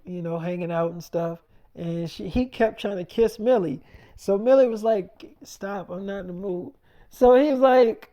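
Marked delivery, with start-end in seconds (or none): 5.4-6.7 softly voiced brush-off, imitating the girlfriend; quiet retold refusal.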